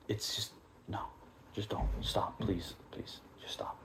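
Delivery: fading to a whisper, begging them to stop; whispered pleading. Real, not acted. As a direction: drop to a hushed plea.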